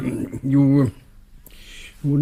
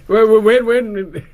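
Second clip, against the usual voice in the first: flustered laughing protest; 9 semitones higher, 4 dB louder, slower than usual. Acted. Coffee being overpoured, laughing.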